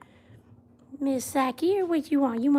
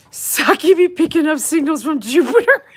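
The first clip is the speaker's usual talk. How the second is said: louder, higher, laughing through it; laughing joke; 6 semitones higher, 8 dB louder than usual. Real, not acted. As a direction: crack yourself up at the joke. Joking about cone dish.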